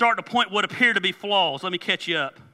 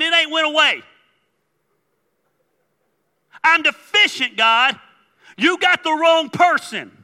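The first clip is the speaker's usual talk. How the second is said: high, loud, hammed-up protest; worked-up Moses; 10 semitones higher, 10 dB louder than usual. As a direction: argue with God at the top of the voice. Paraphrasing Moses' protest to God.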